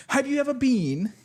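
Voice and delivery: hammed-up British posh question; British accent impression. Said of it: "What's your best British impression?".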